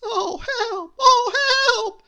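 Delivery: high terrified shriek. Acted. Cabin boy shrieking on the plank. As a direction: squeal for help in terror. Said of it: "shrieked Mister Jelly".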